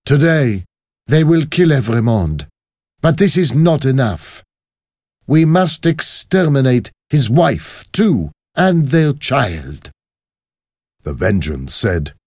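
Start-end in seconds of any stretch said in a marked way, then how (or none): 0.0-9.9 raised, emphatic, merciless pronouncement; high, forceful, ruthless leader; vengeful revolutionary woman. Acted. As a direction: declare a death sentence to conspirators. Voices Madame Defarge.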